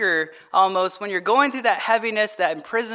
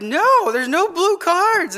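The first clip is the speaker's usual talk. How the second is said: high, drawn-out mock protest; imitated daughter protesting; 9 semitones higher, 5 dB louder, slower than usual. Father imitating his daughter at Uno, high stretched protest. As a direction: play a child insisting, pitched well up.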